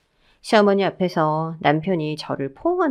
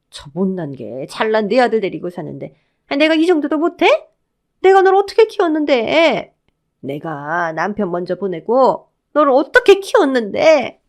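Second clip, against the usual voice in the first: aggrieved, melodramatic outcry; tearful outburst; 2 semitones higher, 6 dB louder than usual. A narrator's reading. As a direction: build from grumbling to a wailing guilt-trip. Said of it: "하면서 눈물을 훔치시면".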